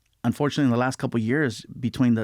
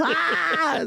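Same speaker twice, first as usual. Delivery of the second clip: high comic "ah" outburst; imitated laughing outburst. Friend falls out at the joke, high imitated outburst. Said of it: "And he fell out".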